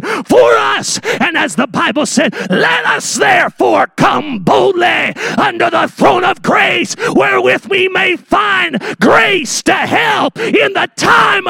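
high, drawn-out preacher's shout; full-force preaching. Real, not acted. Preacher answering doubts that Jesus knows, impassioned shouted preaching. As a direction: cry it out to the congregation at the top of your range.

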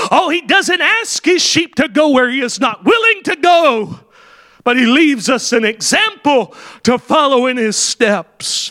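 fiery pulpit preaching; full force preaching. Acted. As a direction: preach it out to the congregation. Preaching the example of Christ.

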